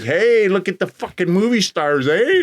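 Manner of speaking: mock catcall at the limo; mocking heckle. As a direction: heckle the arrivals from the street. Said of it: "they're like".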